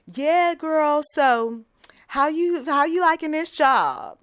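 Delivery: high, loud overfamiliar greeting; put-on familiar talk. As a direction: chum up to her too eagerly. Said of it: "she said something like".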